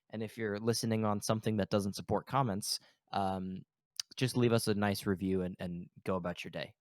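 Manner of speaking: ordinary talk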